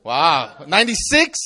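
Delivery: normal talking voice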